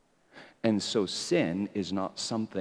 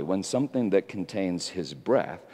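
ordinary delivery

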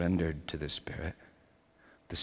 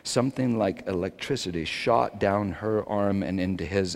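usual speaking voice

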